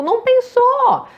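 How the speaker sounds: high, loud, firm denial; a high, loud, emphatic correction. Real, not acted. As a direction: shut the idea down with force. Answering the parents' question, emphatic.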